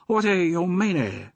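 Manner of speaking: high put-on pidgin English, adding "y" to words; a cheeky opium-den keeper laying on a fake pidgin accent; mock pidgin accent. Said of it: "Adding E to the end of every word".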